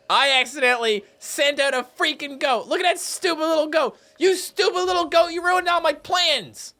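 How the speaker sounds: high-pitched, exasperated scolding; exasperated ranting. Acted. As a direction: scold a stupid animal in a high, hammed-up rant. Rants at goat ruining plan.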